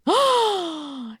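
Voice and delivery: long, high gasp; big excited intake of breath; delighted gasp. Real, not acted. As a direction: gasp at thrilling news. Delighted at promised plush update.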